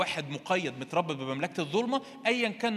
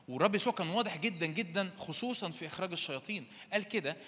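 ordinary delivery

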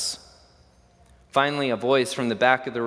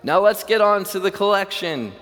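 higher and louder call across the room; shout; 8 semitones higher, 6 dB louder than usual. Acted. Man in the second row shouting at the auctioneer, high and loud. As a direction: shout to move things along.

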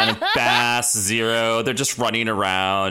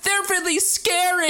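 very high timid fish voice; mock Flounder. Flounder to Ariel, a very high timid squeak. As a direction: squeak it like a scared little fish. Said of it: "Flounder's like".

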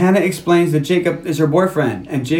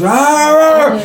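high comic roar; mock angry roar; 8 semitones higher, 4 dB louder, much slower than usual. Jealous father roaring at the older guy, mock enraged. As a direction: roar like an enraged dad, hammed up.

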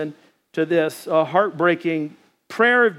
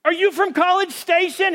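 shouting back; 10 semitones higher than usual. Retold or imitated. Guide shouting back to Josh from the raft, high shout. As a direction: call back across the water. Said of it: "I look over and I'm like".

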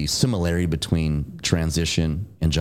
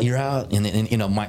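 ordinary delivery

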